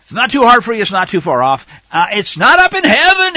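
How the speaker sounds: high, loud, mocking emphasis; sarcastic scripture reading. Preacher mocking the lie that the law is too hard. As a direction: throw the verse back at the doubters. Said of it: "quite a bit of sarcasm in here".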